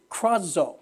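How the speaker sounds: krazo said KRAH-zo, rolled start, slow; krazo, stress on the first syllable KRAH; Greek word krazo. Shown as a demonstration. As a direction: spell out krazo as K R A Z O. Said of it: "Can you say that?".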